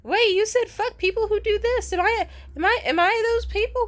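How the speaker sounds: shrieking hammed-up impression; mocking imitation of her. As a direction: parody her defensive panic. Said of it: "she kind of slid up on that and was like".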